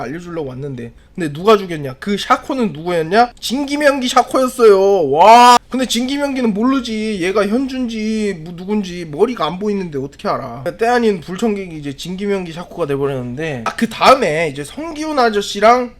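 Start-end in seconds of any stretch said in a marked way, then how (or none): none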